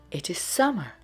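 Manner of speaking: ordinary delivery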